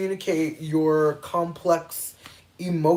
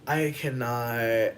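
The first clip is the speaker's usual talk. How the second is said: breaking up laughing; 9 dB louder, much slower than usual. Real, not acted. Failed accent sets off laughing fit.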